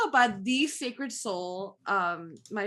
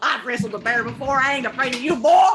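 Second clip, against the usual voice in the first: put-on Appalachian accent; 3 semitones higher, 11 dB louder, faster than usual. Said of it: "Get your mountain accent on".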